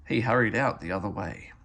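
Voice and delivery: ordinary delivery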